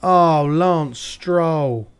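slow, drawn-out, fed-up "Oh, Lance Stroll"; real weary despair at a rival's blunder; exasperated groan. Real, not acted. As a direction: stretch the name out in disgust. Blaming lapped driver.

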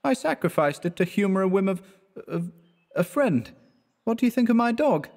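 high-pitched, light student voice; airy, high voice for a joking friend; jaunty young student. Acted. Voices Clifford in the story.

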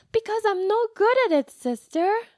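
put-on high, loud childish outburst; little girl blurting out her frustration, high and loud; frustrated little girl.

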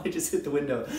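ordinary delivery

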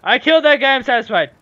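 real victorious gloat; triumphant. Real, not acted. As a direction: crow about the kill. Triumph after a kill.

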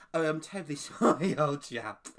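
lightly imitated British politeness with a laugh; British gent impression. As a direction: quote the polite British monster, amused. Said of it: "the actor that did the British accent".

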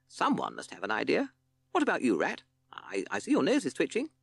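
lightly voiced captain urging his band; prodding leader. A narrator's reading. Captain asking Rat for an idea, prodding.

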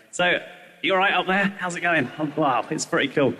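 normal talking voice

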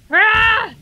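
high, loud drawn-out scream; attack yell. Pretend. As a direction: scream as you lunge at the monster. Yell at the game enemy.

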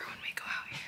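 quiet whisper so parents sleep; hushed whisper at dawn. Real, not acted. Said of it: "I have to whisper".

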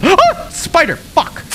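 sudden high gasp of fright; real scare, high yelp; startled yelp. Real, not acted. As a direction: jump at the spider. Startled by spider.

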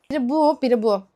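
ordinary talk

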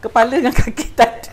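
loud, high-pitched amusement breaking into a laugh; amused, laughing. Real, not acted. Amused at the headless turtle cake. (geli hati, cakap sambil ketawa)

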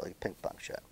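barely voiced whisper; whispered repeat. Real, not acted. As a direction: whisper "like a ping pong shot" to yourself. Muttering repeated coach's phrase.

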